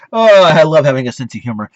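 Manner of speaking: ordinary delivery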